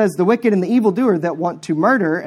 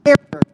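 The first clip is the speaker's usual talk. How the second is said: terror punched high and loud; stressed terror; 8 semitones higher, 5 dB louder than usual. Acted. Preacher warning the evildoer, terror punched high and loud. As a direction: hit the word terror hard.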